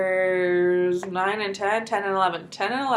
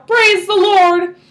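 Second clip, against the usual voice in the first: high, loud, slow praise; joyful yell; 10 semitones higher, 10 dB louder, much slower than usual. A narrator's reading. Grandpa praises the Lord, loud and slow. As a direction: cry out thanks at full voice.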